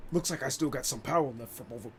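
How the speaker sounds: usual speaking voice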